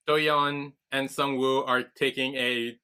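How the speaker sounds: usual speaking voice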